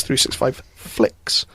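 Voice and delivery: "flicks" with a clear F, slow; measured "flicks" with FL at the front; correct FLICKS. Shown as a demonstration. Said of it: "I'm just saying it's".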